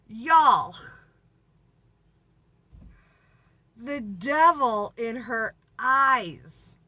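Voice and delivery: slow, loud, drawn-out repeat of the devil in her eyes; dramatic emphasis. Acted. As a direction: lean into it like a spooky punchline.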